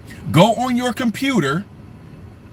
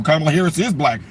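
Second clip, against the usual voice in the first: ordinary talk, nothing marked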